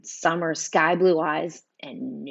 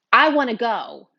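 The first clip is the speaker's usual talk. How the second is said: sudden, louder tearful outburst; bursting out; 5 semitones higher, 6 dB louder than usual. A narrator's reading. Upset girl begging to leave the room, bursting out. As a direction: burst out with it, pitched up. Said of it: "I burst out".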